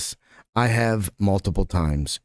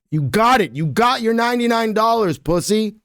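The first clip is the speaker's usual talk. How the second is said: taunting mock voice; 11 semitones higher, 3 dB louder than usual. Reading taunting text aloud.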